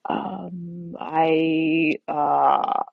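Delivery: drawn-out, halting filler sounds; hesitate, dragging out each sound; stammering hesitation. Retold or imitated. Patient stalling before the neurologist, hesitant.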